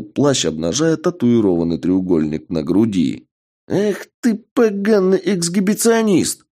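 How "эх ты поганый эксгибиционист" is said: put-on high, furious old man scream; furious old man yell. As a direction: shriek the insult in a rage. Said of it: "В ярости закричал старикашка".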